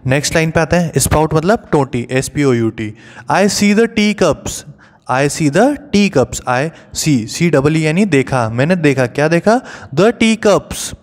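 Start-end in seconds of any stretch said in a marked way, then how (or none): 3.3-4.6 slow and higher, sing-song; recited rhyme line. Shown as a demonstration. Teacher reciting the poem line to the class, slow and raised. 9.9-11.0 slow, higher sing-song; recited rhyme line. Shown as a demonstration. Teacher reciting to children, slow and raised.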